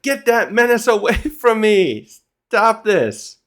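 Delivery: high-pitched mock outrage with a laugh; acted indignant yell, pitch up; hammed-up protest, amused underneath; exasperated protest. Voices Loki protesting at Luffy.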